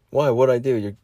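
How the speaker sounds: ordinary delivery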